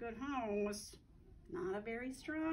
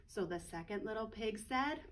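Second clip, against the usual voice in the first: ordinary delivery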